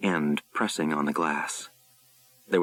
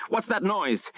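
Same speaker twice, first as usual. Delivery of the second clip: hissed; 13 semitones higher than usual. A narrator's reading. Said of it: "Saint John hissed".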